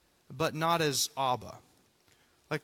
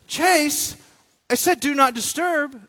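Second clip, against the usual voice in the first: high, loud scolding; mock outburst at being interrupted; acted upset; 9 semitones higher, 9 dB louder than usual. Pastor scolds Chase for barging into his office, upset. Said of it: "if I were to get upset".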